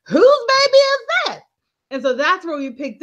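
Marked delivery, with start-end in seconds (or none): 0.1-1.4 mock yell. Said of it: "He's like".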